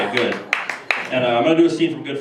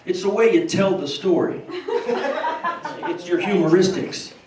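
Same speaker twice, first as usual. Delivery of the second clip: higher, slow, laughing Bush voice; George Bush impression; 5 semitones higher, much slower than usual. Bush explains to Johnny why he's funny, slow and higher. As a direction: stretch it out like Bush. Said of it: "George Bush and Johnny Carson".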